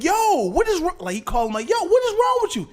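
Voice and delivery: high, incredulous yo; mock outraged voice. Son confronting his father, high incredulous. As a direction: act shocked and offended.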